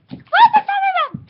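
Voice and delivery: high, loud hunt for the bag; frantic shout. Pretend. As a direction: call out searching.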